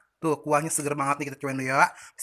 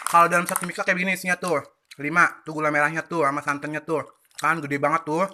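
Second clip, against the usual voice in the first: usual speaking voice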